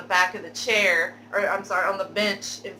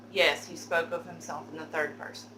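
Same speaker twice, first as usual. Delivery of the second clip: ordinary talk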